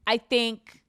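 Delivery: ordinary talk, nothing marked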